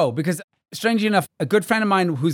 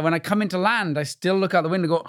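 ordinary delivery

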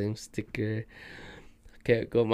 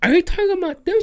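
high, louder nosy-mom voice; imitating mom; 7 dB louder, faster than usual. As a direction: poke at him like a prying mother. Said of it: "Then my mom was all".

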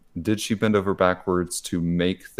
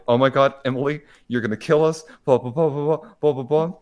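higher, louder mock-frantic rush; mock panic; 7 semitones higher, 4 dB louder, faster than usual. Coworkers panicking at Emily about a work crisis, mock frantic. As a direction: play the team freaking out, half-joking.

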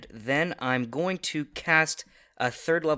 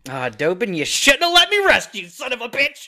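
high, very loud in-character yell; explosive, hammed-up fury; furious battle shout; 9 semitones higher, 13 dB louder than usual. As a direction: roar it at your enemy as you strike. Player attacks in character.

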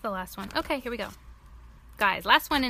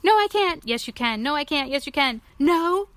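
playful back-and-forth argument; 4 semitones higher, 6 dB louder than usual. Acted. Gerald and Piggy argue back and forth.

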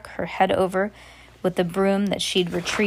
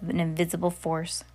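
ordinary delivery

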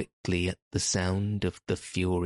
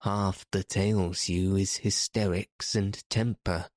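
plain reading voice

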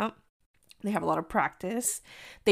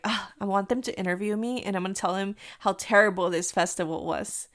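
usual speaking voice

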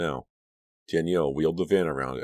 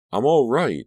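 insisting; 9 semitones higher, 10 dB louder than usual. A narrator's reading. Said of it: "Danielle insisted".

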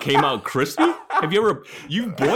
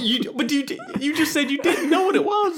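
laughing. Real, not acted. Incredulous laughing accusation.

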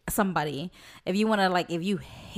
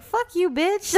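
higher, louder teasing "fuck you"; playful insult; 10 semitones higher, 4 dB louder, slower than usual. Retold or imitated. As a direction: fire back at a friend in jest. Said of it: "she be like".

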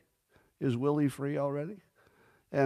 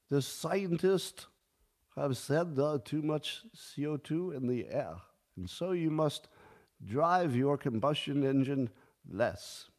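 higher, slow mock Swiss-Austrian accent; 3 semitones higher, slower than usual. Quoting the forum on driving less, in a light mock Swiss-Austrian accent. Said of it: "I'm trying to do a Swiss accent or Austrian".

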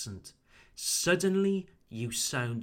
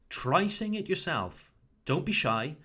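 ordinary delivery

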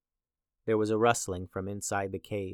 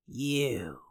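put-on creaking old witch voice; creaky voice; 4 dB quieter than usual. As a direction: let the words creak out. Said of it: "her voice creaked".

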